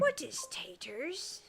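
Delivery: slow, raspy Smeagol whisper. Retold or imitated. Quoting Gollum's taters line to stream chat, whispered creepy. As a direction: breathe it out like a hunched creature. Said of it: "Smeagol for the win".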